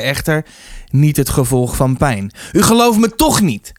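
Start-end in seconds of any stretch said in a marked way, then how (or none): 2.5-3.7 high, upset outcry; shouting. A narrator's reading. Arnold in tears to the rector, high outcry. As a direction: cry out in angry disbelief. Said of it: "schreeuwde hij".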